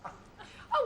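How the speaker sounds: a high squeal of an oh; delighted oh. Acted. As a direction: coo with sudden delight. Woman delighted by the flirt.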